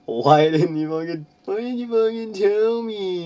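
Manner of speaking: high, loud hammed-up yell; mock shout. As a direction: scream it like a betrayed emo boy. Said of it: "he shouted".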